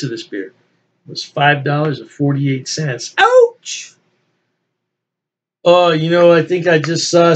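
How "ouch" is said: sudden high yelp at the price; a comic jump up in pitch, stung by the cost; mock ouch. Real, not acted. Reviewer reacting to the price tag with a high, louder yelp.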